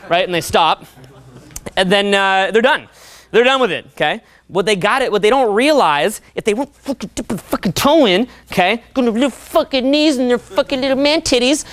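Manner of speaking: ordinary talk, nothing marked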